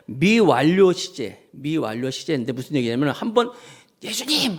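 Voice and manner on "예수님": mock cry. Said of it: "한번 소리 지르는 게 아니라".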